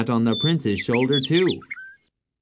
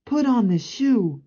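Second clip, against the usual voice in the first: directing prince; 12 semitones higher than usual. Acted. Prince tells Cinderella.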